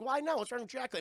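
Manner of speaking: indignant. Retold or imitated. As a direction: stick up for the name. Kid defends the name.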